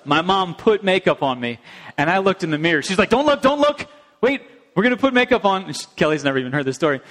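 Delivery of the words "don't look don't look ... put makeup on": excited mom. Retold or imitated. Mom excitedly preparing makeup.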